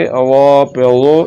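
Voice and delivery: वो thick and rounded; slow, full-mouthed वा पे वो; emphatic Arabic daad in वो; heavy DAAD. Shown as a demonstration. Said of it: "इसको पुर करके पढ़ेंगे".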